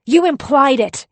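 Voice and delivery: high, loud yell that cuts in. Acted. Husband yells over his wife, cutting her off. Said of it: "he yells, cutting me off".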